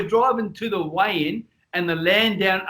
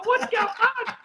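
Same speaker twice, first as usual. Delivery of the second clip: frightened passenger voice; slower than usual. Retold or imitated. Scared passenger in back seat.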